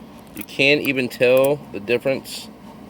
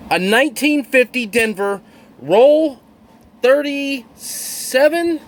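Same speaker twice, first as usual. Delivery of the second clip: much higher, voice jumping up in real excitement; thrilled, shaking with excitement; 7 semitones higher, slower than usual. Real, not acted. Coin hunter finding the key date coin, overjoyed, pitched way up. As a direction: squeal out the find like you can't believe it. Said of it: "I am freaking shaking right now".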